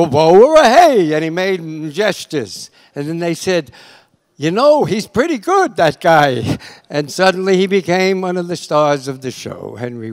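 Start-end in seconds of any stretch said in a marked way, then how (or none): 0.0-1.1 loud, drawn-out mock catchphrase; big hammed-up imitation of a catchphrase; catchphrase character. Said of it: "I had a character who said". 4.4-6.6 louder, higher mock admiration; hammed-up pleasant surprise; impressed executives. Acts out executives impressed by actor.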